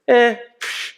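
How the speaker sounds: sharp high scoffing huff; a high, loud brush-off noise, like tossing something away; dismissive scoff. Retold or imitated. Little girl tossing the doll aside, high dismissive scoff.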